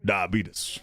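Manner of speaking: low drawled Southern American impression; Southern American accent. Shown as a demonstration. Streamer demonstrating an accent warm-up phrase, Southern American accent. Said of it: "helped me do a Southern Amer-, American accent is whispering under my breath".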